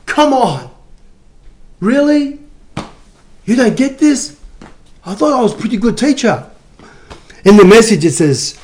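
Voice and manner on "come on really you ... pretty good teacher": high-pitched mock exasperation, with pauses between questions; Jesus. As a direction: act out an incredulous teacher let down by pupils. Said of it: "I could just see Jesus going".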